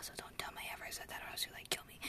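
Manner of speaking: very soft, hurried whisper; secretive whisper. Pretend. Speaker hiding a grandpa joke from dad, secretive whisper.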